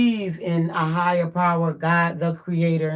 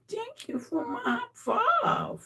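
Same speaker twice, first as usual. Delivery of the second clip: cooing praise; 5 dB quieter, slower than usual. Real, not acted. Owner thanking the pet for a high five, high cooing. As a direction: squeal it sweetly and slowly.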